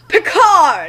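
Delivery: loud triumphant name reveal; triumphant shout of the winner's name; climactic reveal. Acted.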